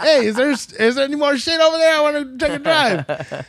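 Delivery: eager mock request. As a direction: beg for leftovers, wide-eyed. Eagerly asking for more food.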